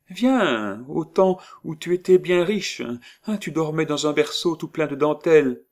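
put-on old woman. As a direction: sweet-talk her, a little insistent.

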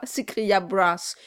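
ordinary delivery

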